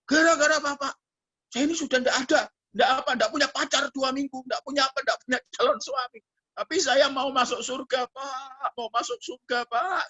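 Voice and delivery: put-on high-pitched, pleading girl's part; imitated plaintive plea. Girl pleading that she wants heaven, imitated plaintive voice. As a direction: wail the complaint, voice held high.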